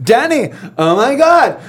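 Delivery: high-pitched, overexcited imitation; mock gushing reply. Husband mimicking the coworker greeting his wife back, mock gushing. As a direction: squeal the name back in mock delight.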